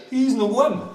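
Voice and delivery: high-pitched little dwarf; dwarf's voice. Acted. First dwarf speaks in tale.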